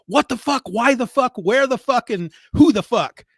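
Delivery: high, loud mock outburst; imitated yelling. Spy boss answering his clueless agent, imitated yelling. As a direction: fire off each question as a hammed-up yell. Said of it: "yelling at him at one point".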